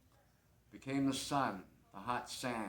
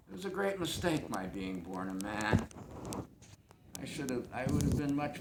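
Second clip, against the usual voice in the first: louder, higher-pitched self-mockery; wry outburst; 5 semitones higher, 5 dB louder than usual. Regrets being born a man, wry outburst. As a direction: burst out with rueful irony. Bitter turn in the monologue.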